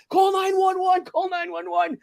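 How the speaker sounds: high, held call for help; urgent calling out. Retold or imitated. Bystander telling another driver to get help, urgent calling. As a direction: imitate calling across a street, pitched high.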